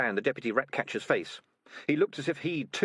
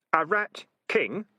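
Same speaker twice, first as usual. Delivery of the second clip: slow, sharp questioning echo; sharply; 8 semitones higher, 4 dB louder, slower than usual. Acted. Keith questioning the ratcatcher's confession, sharply. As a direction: repeat it as a sharp challenge. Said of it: "said Keith sharply".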